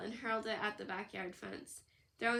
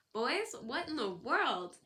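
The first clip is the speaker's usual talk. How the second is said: slow, loud, higher startled mother; shocked mom; 4 semitones higher, 5 dB louder, slower than usual. A narrator's reading. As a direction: catch the boys mid-mischief, aghast. Mom finds boys hollering outside.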